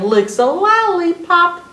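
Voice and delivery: slow it down and swing the pitch up; playful drawn-out reading. Acted. Reader finishing an L rhyme for children, playful drawn-out reading.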